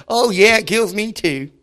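hammed-up moaning along about the heat; mock complaining. Churchgoer moans along about the weather, mock whiny.

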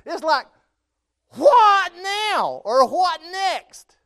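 high, loud, fervent preaching; preaching at full force. Acted. Preacher to congregation, describing Jonah's pain, fervent full-force preaching. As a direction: drive the suffering home to the congregation.

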